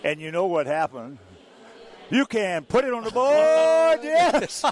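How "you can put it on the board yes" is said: big, high, loud, slow broadcaster's home run call; booming play-by-play call with a laugh at the end. Retold or imitated. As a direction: call it like the ball is leaving the park. Retelling his home run call.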